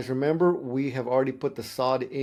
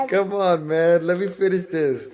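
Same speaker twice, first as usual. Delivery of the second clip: laughing; 5 semitones higher, 5 dB louder than usual. Real, not acted. Dad laughs, interrupted by kid.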